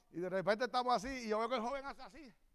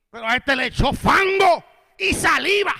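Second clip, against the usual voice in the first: loud, higher preaching shout; emphatic exclamation; 7 semitones higher, 17 dB louder than usual. Real, not acted. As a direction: hammer out the surprising detail.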